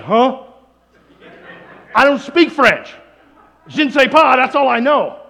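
loud, high baffled protest; baffled denial. Acted. Girl to French professor, baffled denial. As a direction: protest in bewilderment.

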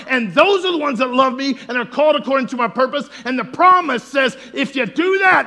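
loud, high preaching voice, building to shouts; fiery sermon delivery; full-force preaching. Acted. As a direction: preach it out to the congregation.

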